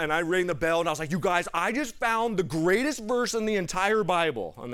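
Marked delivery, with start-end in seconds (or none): 1.1-4.5 loud, high, eager announcement to friends; excited announcement. Retold or imitated. Young [name] calling out to the dining hall, bursting with excitement. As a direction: burst in with big news.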